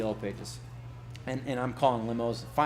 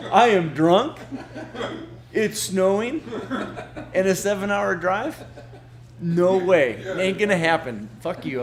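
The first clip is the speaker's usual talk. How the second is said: laughing; 6 semitones higher, 7 dB louder than usual. Retold or imitated. Laughing through the retold refusal.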